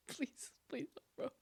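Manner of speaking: soft whispered begging; whispered plea. Real, not acted. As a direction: whisper please.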